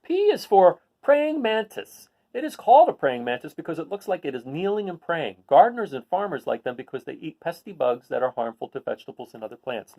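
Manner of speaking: picture-book read-aloud voice; reading to children. Acted. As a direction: read it to a class of kids.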